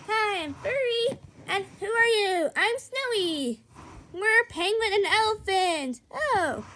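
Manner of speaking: ordinary talk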